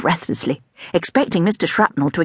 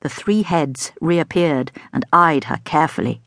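usual speaking voice

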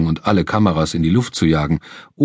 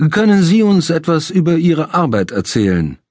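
talk show host; 8 semitones higher than usual. Acted. Larry the host voiced.

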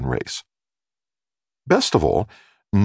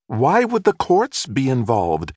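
slow, higher prying neighbor woman; nosy neighbor; 8 semitones higher than usual. A narrator's reading. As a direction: ask it like a curious busybody.

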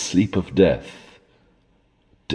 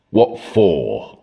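sulky growl; 2 semitones lower than usual. A narrator's reading. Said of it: "growled he sulkily".

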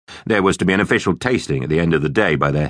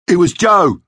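high, loud tattling; accusing shout; 12 semitones higher, 5 dB louder than usual. A narrator's reading. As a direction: shout the blame across the classroom. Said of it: "he shouted".

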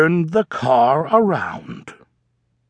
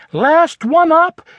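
higher louder challenge; teasing call; 11 semitones higher, 5 dB louder than usual. Acted. Yasha daring the campers to get up, higher louder call. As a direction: call out the dare.

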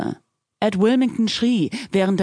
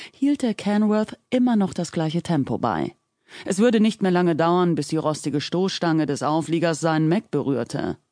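ordinary delivery, nothing marked

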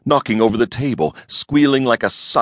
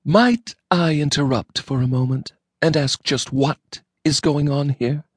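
icy politeness; 8 semitones higher than usual. A narrator's reading. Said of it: "a tone of voice of a temperature approaching absolute zero".

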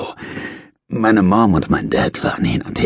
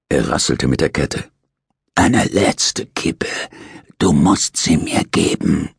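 slow, half-whispered begging from a dying man; pleading old convict; slower than usual. Acted. Dying prisoner pleads with his nurse for a last cigarette, slow and half-whispered.

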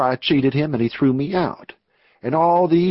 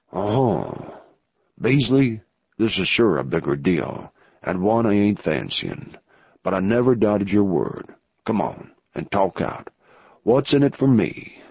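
lower outlaw voice; 5 semitones lower than usual. Acted. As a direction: play the rough outlaw weighing the risk. Narrator voices outlaw Anson.

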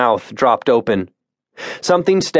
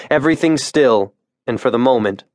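usual speaking voice

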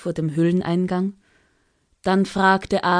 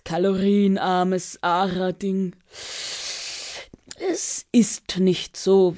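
gelangweilter Drache; slower than usual. Acted. Dragon explains his woes.

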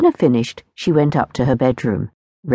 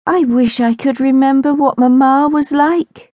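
wistful sigh; 11 semitones higher, 4 dB louder than usual. A narrator's reading. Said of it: "She sighed".

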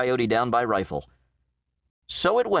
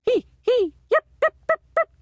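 shrill yipping coyote howl, far higher and louder; 5 dB louder, faster than usual. A narrator's reading. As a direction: yip and yammer like a coyote in the night. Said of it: "a shrill, yammering, nickering skirl of sound".

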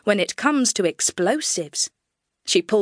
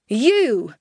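Texan shout; 3 semitones higher, 4 dB louder than usual. Acted. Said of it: "in my best Texan drawl".

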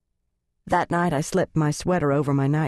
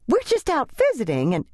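higher, hurried excuse trailing off; flustered church lady; 9 semitones higher than usual. Acted.

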